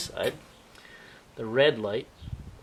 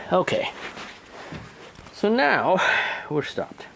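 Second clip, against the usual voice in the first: breathless after chasing robots; puffing between words; out of breath; 9 semitones higher, slower than usual. Real, not acted. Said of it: "A little out of breath".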